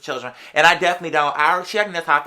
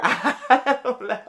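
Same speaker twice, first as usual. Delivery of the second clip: laughing; 8 semitones higher, much slower than usual. Real, not acted. Laughing after race remark.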